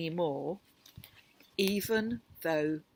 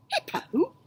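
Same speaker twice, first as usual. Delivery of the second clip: amazed. Acted. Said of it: "He giggled".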